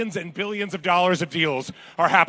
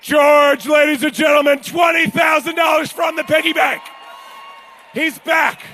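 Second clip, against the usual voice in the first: high, loud showman shout; excited announcement; 9 semitones higher, 5 dB louder, slower than usual. Real, not acted. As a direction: auctioneer hyping up little George to the room. Announcing the child bidder.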